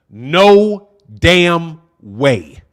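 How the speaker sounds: drawn out, each word weighted; slow emphatic denial. Real, not acted. Dismissing a viewer's comment, drawn out and slow. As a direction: stretch it out in flat disbelief.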